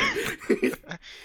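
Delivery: breathless squeal of laughter; high squealing laugh. Real, not acted. Laughing hard.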